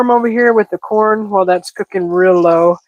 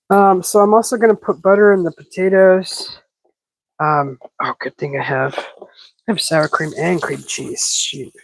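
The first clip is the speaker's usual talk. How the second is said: ordinary talk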